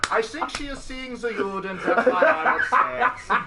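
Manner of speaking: high, loud mock-German accent through laughter; hammed-up German accent, cracking up; laughing German accent. Friend joins the German bit, laughing mock-German accent.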